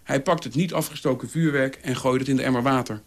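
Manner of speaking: plain reading voice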